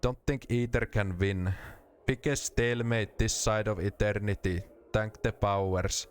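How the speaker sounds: put-on rally-English Finnish accent. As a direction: speak English like a Finn. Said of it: "What if I gave him a Finnish accent?".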